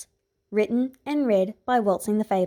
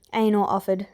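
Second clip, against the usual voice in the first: usual speaking voice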